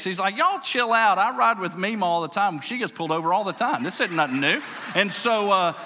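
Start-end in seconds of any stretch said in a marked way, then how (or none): 0.4-4.6 lightly voiced little kid, higher and louder; six-year-old boy. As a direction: play a kid telling everyone to relax. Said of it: "he's, like, six".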